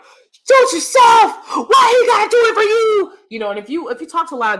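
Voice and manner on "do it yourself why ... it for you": high, loud mock yell of the relative; shrill-pitched scolding shout, hammed up; mocking angry shout. Relative yelling at her over the spider, high mock shout. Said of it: "estranged family member was like".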